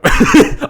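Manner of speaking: short high burst of laughter; loud laugh. Real, not acted. Laughs at own discovery.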